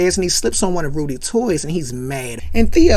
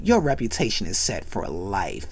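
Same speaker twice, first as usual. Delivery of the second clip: deadpan friend; 10 semitones lower, 9 dB quieter than usual. Retold or imitated. Yellow Shirt deadpan low.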